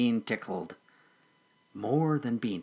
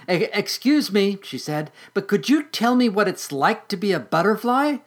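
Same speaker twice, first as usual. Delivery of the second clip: higher, halting polite question; polite shy caterpillar; 7 semitones higher, 4 dB louder than usual. Acted. Patty politely asking a passing butterfly, higher and hesitant. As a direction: ask a stranger timidly.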